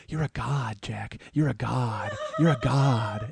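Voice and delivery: low, soft repeated praise; hushed admiration. Retold or imitated. Stuntmen escorting Jack back, low hushed admiration. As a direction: say it softly, like an aside to a star.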